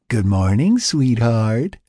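loud, singsong mock-sweet greeting; teasing. A narrator's reading. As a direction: tease with a lilting, rising good morning. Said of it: "Tony teased".

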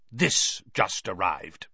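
slow, measured uncle's character voice; gruff uncle. A narrator's reading. Uncle confronting Harry with a letter, gruffly. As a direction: voice a stern uncle, drawn out.

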